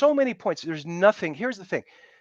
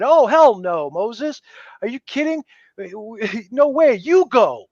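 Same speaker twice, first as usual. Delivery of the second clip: mock loud refusal; 3 semitones higher, 6 dB louder than usual. People refusing to climb with Moses.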